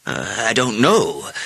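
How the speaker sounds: slow, hesitant mayor; reluctant admission. A narrator's reading. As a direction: admit it haltingly, dragging the words. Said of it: "the mayor admitted".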